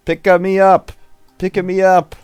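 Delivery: high, eager tiny voice. Acted. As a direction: beg like an excited puppy. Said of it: "raises up its claws like a puppy".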